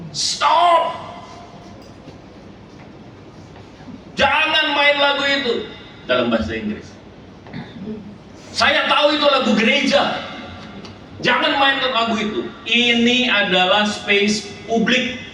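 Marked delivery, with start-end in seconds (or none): 0.1-5.5 high-pitched yell acting out an objector; retold angry shout. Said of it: "dia teriak". 8.5-15.2 very high, strained imitation of yelling; retold shouted protest. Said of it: "dia teriak".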